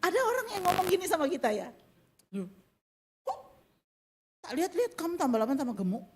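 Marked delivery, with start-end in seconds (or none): 2.4-6.1 mock blunt remark. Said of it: "saya mau tiru ya".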